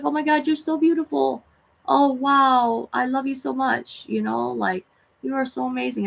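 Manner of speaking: high, cooing praise to a tiny spider; gushing baby talk. Retold or imitated. Telling a little orange spider she loves it, in a high cooing gush. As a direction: coo at it like a pet you adore.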